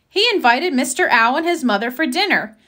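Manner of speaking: usual speaking voice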